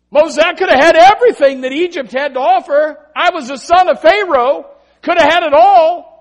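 loud, high, impassioned Moses; preached voice of Moses giving it all up; Moses speaking. Acted. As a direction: cry it out as Moses, full of fire.

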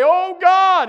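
high, loud cry to God; desperate call to God. Shown as a demonstration. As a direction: cry it out in need. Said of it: "I can just cry out".

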